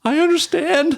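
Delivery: put-on high small kid voice; scared little kid. Bullied boy answering the big brother, small high voice. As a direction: squeak it like a frightened boy.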